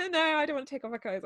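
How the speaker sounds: fast, laughing protest; laughing refusal. Retold or imitated. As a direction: rush it out through laughter.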